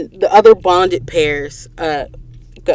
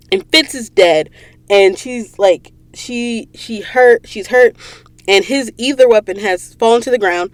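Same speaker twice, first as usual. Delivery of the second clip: ordinary talk, nothing marked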